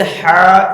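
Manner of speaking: a drawn-out হা pressed from the throat; hard throaty ha. Shown as a demonstration. Preacher singling out the letter for listeners, hard throaty ha. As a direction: hold হা tight in the throat. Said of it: "কঠোর করে আমি পড়েছি".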